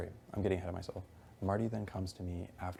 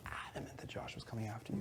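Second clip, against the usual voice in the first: softer, breathy whisper of "Adamant"; hushed whisper repeating the accusation; 3 semitones higher, 8 dB quieter than usual. Retold or imitated.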